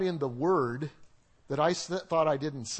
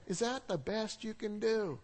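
martyr-perfectionist jab; 5 semitones higher, slower than usual. Retold or imitated. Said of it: "mix the martyr with the perfectionist".

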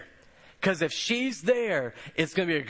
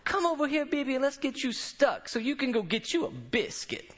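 high, sing-song Charlestonian drawl of a grandmotherly nurse; 7 semitones higher than usual. Retold or imitated. Nurse promises the fasting pastor a biscuit: high Charlestonian drawl. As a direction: tease him kindly in a Charlestonian drawl. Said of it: "has this thick Charlestonian drawl".

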